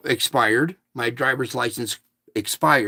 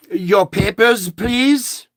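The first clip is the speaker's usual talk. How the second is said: higher, slower imitation of a German-accented clerk; 6 semitones higher, 4 dB louder, much slower than usual. Shop clerk asking a customer for ID, imitated German accent. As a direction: mimic her German accent, pitched up and drawn out. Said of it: "with a German accent".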